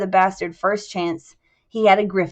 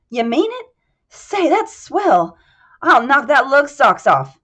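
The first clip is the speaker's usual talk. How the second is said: a boxer thrilled at the double cut; excited boxer; 6 semitones higher, 7 dB louder than usual. Acted. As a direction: burst with glee.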